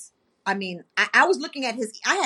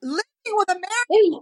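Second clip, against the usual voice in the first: retold crying; 9 semitones higher, 4 dB louder than usual. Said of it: "I'm over here crying like".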